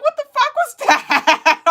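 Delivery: high, loud, laughing disbelief; high startled yell; shocked outburst. Retold or imitated. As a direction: blurt it out half-laughing in shock. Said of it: "And I'm like".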